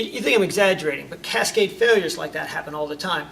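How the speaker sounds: ordinary talk, nothing marked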